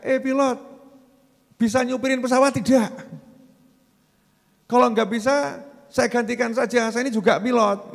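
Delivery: bossy yell of a know-it-all; mock shouting passenger. Passenger shouting at the pilot from the cabin, mock yell. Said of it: "Terus dia teriak-teriak".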